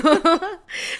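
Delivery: sudden high loud laugh; burst of high laughter. Real, not acted. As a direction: laugh out in disbelief. Laughing at the answer.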